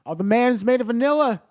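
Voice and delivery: gleeful loud joke; excited. Real, not acted. Joking about vanilla man.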